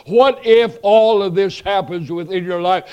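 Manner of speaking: loud, driving sermon voice; fiery preaching. Acted. Preacher thundering at the congregation about judgment, full force.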